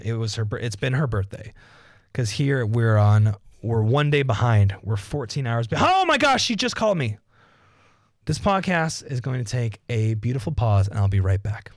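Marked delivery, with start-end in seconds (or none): 5.8-7.2 high-pitched exclamation, cutting in; excited outburst. Pretend. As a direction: burst in with a high, excited cry. Interrupts himself for a phone call.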